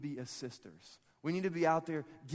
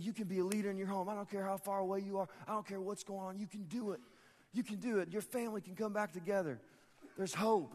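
imitating an earnest preacher, pitched up; Pastor Rick; 5 semitones higher than usual. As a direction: preach encouragement in an insistent, higher voice. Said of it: "This is my best Rick impression".

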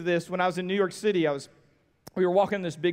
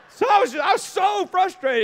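high, loud exasperation; worked-up retold exasperation; frustrated; 10 semitones higher, 5 dB louder than usual. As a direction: play up the frustration, pitched way up. Retelling frustration at the hotel.

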